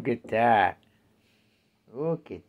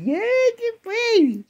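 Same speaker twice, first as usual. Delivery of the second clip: much higher and louder cooing; 10 dB louder than usual. Real, not acted. Owner praising her cat in a high squeal. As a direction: coo at him in a squeal.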